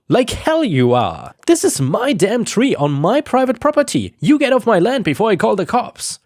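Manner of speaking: high angry shouting at the crew chief; giving an earful. Retold or imitated. Homeowner telling the crew chief to leave: angry yell. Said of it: "about to get an earful".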